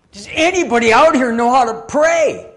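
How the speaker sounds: captain's loud, high shout; calling out. Acted. Captain to crew as the ship sinks, urgent shout. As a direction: holler it to the whole crew. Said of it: "He called out and he said".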